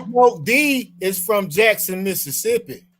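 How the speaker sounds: slow, drawn out telling; slow emphatic. Real, not acted. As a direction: stretch each word out.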